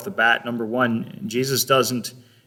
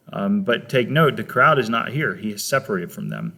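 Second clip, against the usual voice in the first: usual speaking voice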